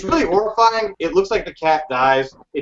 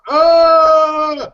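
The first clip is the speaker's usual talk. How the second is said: loud, higher "Oh!" in a Bill Murray yelp; 8 semitones higher, 5 dB louder than usual. Retold or imitated. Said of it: "goes full Bill Murray and goes".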